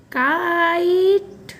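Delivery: Kite with a long stretched vowel; slow teaching model of kite; slow read-along. Shown as a demonstration. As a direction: draw out kite for pupils to join in. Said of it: "फिर से एक बार साथ में पढ़िए".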